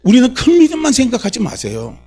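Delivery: high, urgent preacher's delivery. Real, not acted. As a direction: exhort the congregation in a high voice. Preaching at raised pitch.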